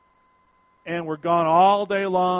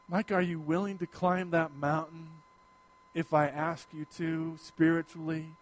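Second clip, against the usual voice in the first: God's gentle call; 4 semitones lower, 8 dB quieter than usual. Acted. God quietly asking the pastor to sacrifice, gentle. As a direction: ask it quietly, searching the heart.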